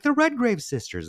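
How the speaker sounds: high-pitched mock mother, thrilled by a name; lilting, high imitation of a mother; imitated mother. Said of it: "my mother being like".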